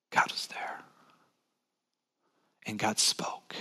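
awed whisper in the stillness. Real, not acted. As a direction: breathe it out like the still small voice. Hushed climax of still voice.

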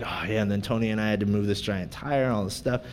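sheepish low-key account of the day; offhand recap. Retold or imitated. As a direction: play it down quietly and fast. Retelling his day to his wife.

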